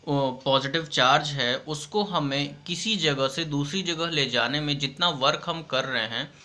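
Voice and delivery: usual speaking voice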